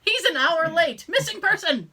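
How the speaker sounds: loud, high mock-panicked report, half laughing; mock urgent report. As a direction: blurt out a hammed-up emergency report.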